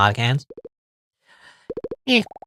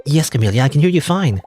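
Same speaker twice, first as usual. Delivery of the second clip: usual speaking voice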